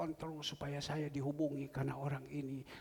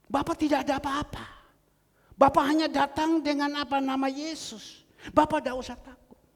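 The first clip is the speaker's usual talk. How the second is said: higher, louder sermon cadence; fiery preaching; 9 semitones higher, 11 dB louder than usual. Acted. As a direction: rouse the congregation with certainty. Preaching encouragement at full force. (berkhotbah dengan semangat dan suara lantang)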